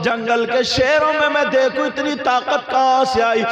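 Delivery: high, loud wailing sermon voice with slow stretched lines; full-force preaching. Acted. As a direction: cry the story out to the crowd at full force. (ऊँची, ज़ोरदार तक़रीर की आवाज़)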